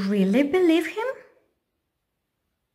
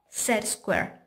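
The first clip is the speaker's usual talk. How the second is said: usual speaking voice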